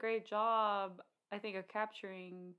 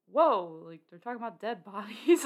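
shocked whoa; 10 dB louder than usual. Retold or imitated. Viewer shocked by Fargo scene.

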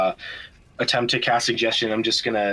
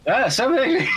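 excited cheering-on; 14 semitones higher, faster than usual. Real, not acted. Excited echo of summoning request.

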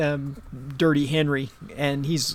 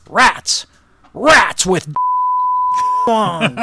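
higher, louder eager shout, laughing through it; Henry's punchline yell; 13 semitones higher, 7 dB louder, much slower than usual. Acted. Joke's foul-mouthed kid answers the teacher with a laughing shout. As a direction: blurt the punchline like a cheeky kid. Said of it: "And Henry says".

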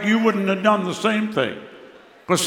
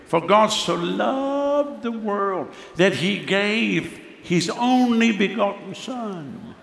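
slow solemn scripture; 3 semitones higher, much slower than usual. Acted. Scripture recited slowly and softly.

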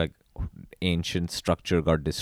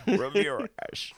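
Sean Connery impression; 13 semitones higher, 11 dB louder than usual. Riffing on Highlander casting, hammed-up Scottish Connery. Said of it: "I'm Sean Connery".

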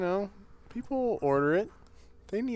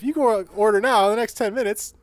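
home shopping host; 5 semitones higher, 4 dB louder than usual. Retold or imitated. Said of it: "used car commercials you see on local television".

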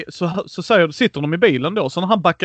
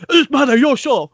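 higher, louder impatient order; retold bossy outburst; 8 semitones higher, 4 dB louder than usual. Player seizing the wheel from his friend, impatient shout. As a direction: take over with a mock shout.

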